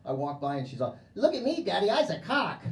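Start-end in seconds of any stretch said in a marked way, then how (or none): 1.1-2.6 put-on higher child voice, proud; a little girl showing off to Daddy. Voices five-year-old daughter.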